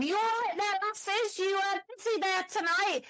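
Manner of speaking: high put-on officer voice, teasing; mock police officer. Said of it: "Two police officers behind them going".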